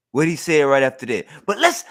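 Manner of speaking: usual speaking voice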